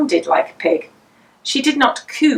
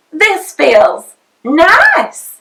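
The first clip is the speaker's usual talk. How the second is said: very slow, higher, louder savoring; mother enjoying mud between her toes, stretched out slowly; delighted discovery; 8 semitones higher, 6 dB louder, much slower than usual. A narrator's reading.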